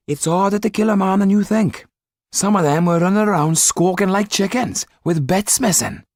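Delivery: high, voiced townsfolk trading rumours; gossiping crowd voice.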